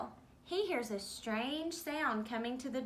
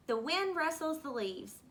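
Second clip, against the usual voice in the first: plain reading voice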